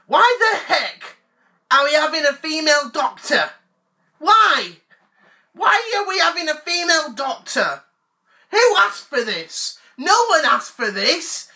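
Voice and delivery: high-pitched aggrieved complaining; whiny indignant rant. Acted. Fan complaining about a female Doctor, high and whiny. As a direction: complain like a wronged fanboy.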